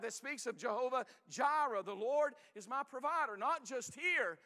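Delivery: full-throated preaching climax. Acted. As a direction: build the congregation up, hitting each phrase. Preaching builds to full force.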